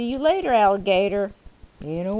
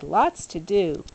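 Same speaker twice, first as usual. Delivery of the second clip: ordinary delivery, nothing marked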